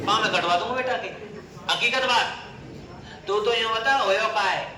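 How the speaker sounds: fierce threat. Acted. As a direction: roar threats of punishment. King threatens punishing betrayers.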